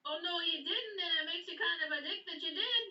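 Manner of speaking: high-pitched calling back; arguing back. Acted. Player's character argues back with yelling goblins.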